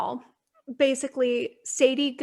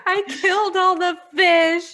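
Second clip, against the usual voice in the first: put-on little girl confessing; 6 semitones higher, 5 dB louder than usual. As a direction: confess it like a guilty kid. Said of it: "they start crying".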